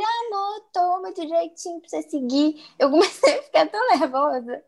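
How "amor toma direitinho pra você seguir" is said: high coaxing mimic of the husband; mock husband. Wife mimicking husband in a high coaxing voice. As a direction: put on a high sweet nagging voice.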